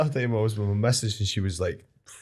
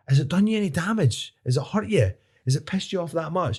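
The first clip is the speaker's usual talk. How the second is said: high-pitched indignant questioning at normal volume; retold argument; 8 semitones higher than usual. Driver challenging an old man at his window, mock indignant. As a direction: throw incredulous questions, pitched well up. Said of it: "People see me shouting at him".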